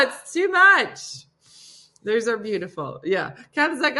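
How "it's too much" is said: high, slow, loud and emotional; overwhelmed wail. Real, not acted. Overcome by viewers' tributes, tearful laughing wail. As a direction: stretch it out, between laughing and crying.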